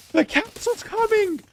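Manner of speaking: high, slow cry of alarm; panicked caster call; alarmed yelp. Real, not acted. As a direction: sound the alarm, voice cracking high.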